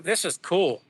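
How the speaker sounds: ordinary talk, nothing marked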